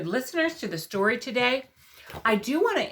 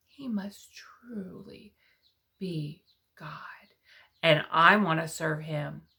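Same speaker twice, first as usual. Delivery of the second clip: hushed awe; 5 semitones lower, 5 dB quieter than usual. Acted. King's awed conclusion.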